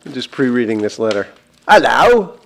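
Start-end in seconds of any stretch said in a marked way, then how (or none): 1.7-2.4 loud, hammed-up Cockney greeting; mock Cockney. Host opening a listener's letter, in mock Cockney. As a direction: open the letter in broad Cockney. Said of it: "could we have more Cockney accents".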